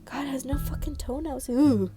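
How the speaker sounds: ordinary talk, nothing marked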